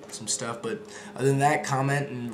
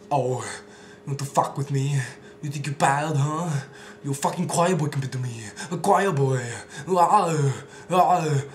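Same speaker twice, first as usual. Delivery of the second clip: slow, menacing mock devil voice building louder; devil taunting Arnold; 3 semitones higher, 5 dB louder, slower than usual. Devil taunting Arnold, mock menacing voice. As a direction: sneer it like a devil mocking a hero. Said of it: "and he's just like".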